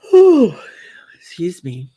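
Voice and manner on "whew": loud open yawn; big yawn. Real, not acted. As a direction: yawn it out fully. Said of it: "Yawning's really good for you".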